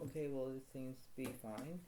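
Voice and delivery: ordinary talk, nothing marked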